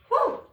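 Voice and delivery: high whoo; excited whoop. Real, not acted. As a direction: let out a sharp whoo. Cheering in demo of squat.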